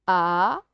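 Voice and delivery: "Arrosoir" as separate a, ro, soir; syllable by syllable. Shown as a demonstration. Teacher sounding out syllables for a child, "Arrosoir" broken up. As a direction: break "Arrosoir" into three stretched beats. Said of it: "de faire les syllabes".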